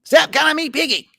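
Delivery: very high, loud whiny cartoon kid; Eric Cartman impression. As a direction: whine it like a bratty cartoon boy. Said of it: "what's the saying that Eric Cartman says".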